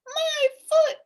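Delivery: high, loud yelp; pained shout. A narrator's reading. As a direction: cry out at the hurt. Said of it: "shouted the bee".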